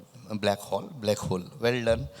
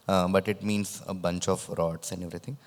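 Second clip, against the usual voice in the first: ordinary talk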